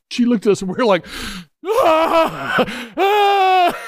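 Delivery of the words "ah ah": high mock wail of parents crying; mock bawling. Parents watching their daughter vanish down the escalator, mock high wail. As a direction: wail like heartbroken parents, half in jest. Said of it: "we're both just bawling".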